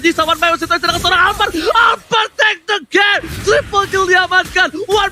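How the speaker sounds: high hype play-by-play call; fighting game announcer. Real, not acted. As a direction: shout it like an esports caster. Hyped play-by-play of a triple kill.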